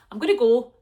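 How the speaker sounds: usual speaking voice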